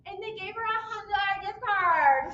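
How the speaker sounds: usual speaking voice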